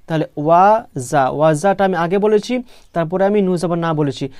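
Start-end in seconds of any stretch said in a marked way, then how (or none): none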